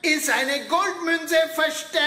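slow, mysterious host voice; theatrical riddle-master narration; dramatic game announcement. Acted. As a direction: lay out the quest like a treasure-hunt master. Host reads out the game rules.

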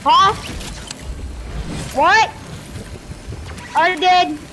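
can't believe he died, protesting; outraged disbelief. Real, not acted. Sudden death, outraged disbelief.

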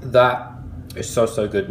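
talking between bites; chewing while talking. Real, not acted. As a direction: mouth partly full of pastry. Seen 1: bites pastry at -2, lips working while talking after.

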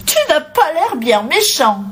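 put-on high child's voice, offhand; little girl. A narrator's reading. Little girl shrugging off the wolf, offhand. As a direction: toss it off in a little girl's pitch. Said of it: "fit la petite fille".

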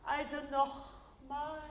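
ordinary talk

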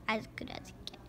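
breathy whispered aside. Acted. Narrator whispering a wry aside to the listener. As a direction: drop to a secret whisper.

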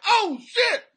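high, loud, comic alarm; high-pitched mock panic; panicked deer. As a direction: yelp it like a spooked deer spotting the hunter. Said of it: "the deer are like".

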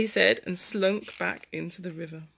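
put-on lively story narration for children; dramatic storytelling. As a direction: read the tussle with big dramatic swings.

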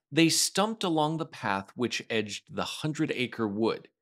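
ordinary delivery

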